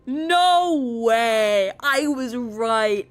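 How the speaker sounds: loud, wailing cry of disbelief, dragged out; shocked disbelief. Real, not acted. Player reacts to her beast guess coming true, shocked wailing. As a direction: wail it out loud, stretching the words. Reacting to a predicted plot twist.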